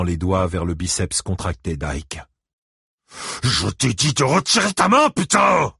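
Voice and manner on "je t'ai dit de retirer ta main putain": high, loud, building shout; furious yell. A narrator's reading. Ike ordering a drunk to take his hand off, yelling. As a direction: yell it, peaking on the swear word. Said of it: "hurla Ike".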